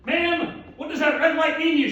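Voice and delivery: hammed-up cop at the car window; stern cop. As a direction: play the traffic cop questioning her. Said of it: "and the cop said".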